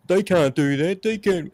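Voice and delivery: high whiny protest; mock whiner. Mocking a hypocrite who objects to protests, high and whiny.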